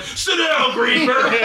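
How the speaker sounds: high-pitched mock heckle, no real volume; imitated heckling crowd. Whole section to Doug during the game, mock yelling. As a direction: squeal it like an annoyed section of fans. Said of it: "the whole section was like".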